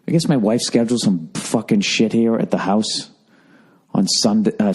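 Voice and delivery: usual speaking voice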